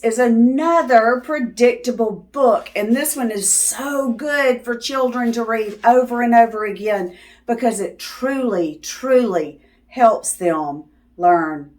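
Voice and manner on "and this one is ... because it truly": ordinary delivery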